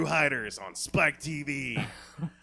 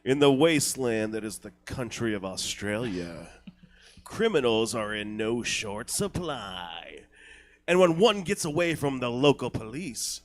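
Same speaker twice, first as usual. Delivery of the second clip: dramatic trailer voiceover; trailer narration; 2 semitones lower, 4 dB louder than usual. Acted. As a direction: narrate like a gritty action trailer.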